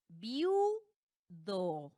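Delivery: "viudo" slowly split as VIU-do, long stressed U. Shown as a demonstration. Teacher demonstrating the diphthong to students, "viudo" as slow VIU, then do. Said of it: "la sílaba tónica recae en la U".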